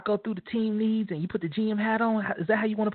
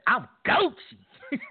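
slow, drawn-out, higher slang line; like the young kids say; 7 semitones higher, 3 dB louder, much slower than usual. Shown as a demonstration. Showing Chuck how the kids say it, slow drawn-out slang. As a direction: stretch it out like a hip kid would. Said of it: "you gotta say it like the kids say".

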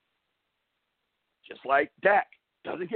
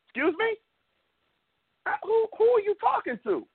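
much higher, incredulous "excuse me?"; indignant disbelief. Retold or imitated. Host to rude Facebook fan, indignant disbelief. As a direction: act offended and amazed at the heckler.

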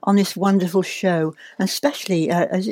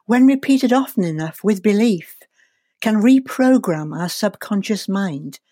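ordinary talk

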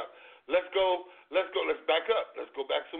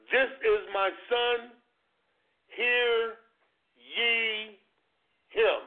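voice of God from heaven; 3 semitones higher, much slower than usual. Retold or imitated. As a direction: proclaim it slowly like God speaking from the clouds. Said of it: "a voice came from heaven".

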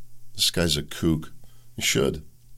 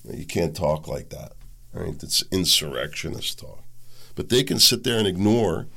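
usual speaking voice

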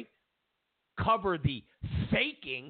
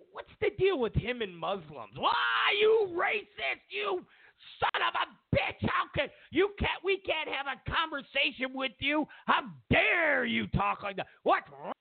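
high, shrill-free mock fury, much higher; mock outraged shouting; 9 semitones higher than usual. Pundit yelling at a questioner in mock outrage. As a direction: shout down the critic in fake outrage. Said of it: "if you just dare to go".

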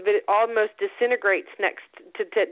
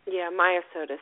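ordinary delivery

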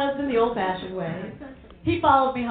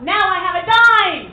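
triumphant shout; 6 semitones higher, 10 dB louder than usual. Acted. Triumphant shout back at grandma.

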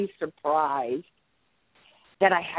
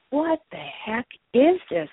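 startled disbelief; 11 semitones higher, slower than usual. Retold or imitated. Startled reaction to sudden pain.

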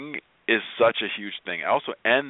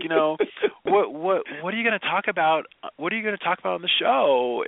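imitating his daughter; 9 semitones higher than usual. Dad voicing his young daughter.

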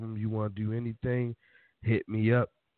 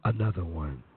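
[name] voice; 8 semitones lower than usual. Pretend. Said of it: "do D J Khaled voice".

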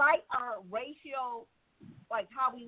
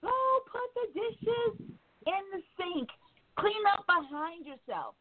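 slow, higher, patient parent voice; calm parent request; 9 semitones higher than usual. Retold or imitated. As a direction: ask the kid gently and slowly. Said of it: "you say things calmly".

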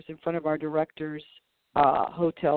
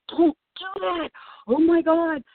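much higher, louder mock panic; panicked plea; 11 semitones higher, 5 dB louder than usual. Telling a friend in customs to stop, in high mock panic. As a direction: gasp it out like it's all going wrong. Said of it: "I'm like".

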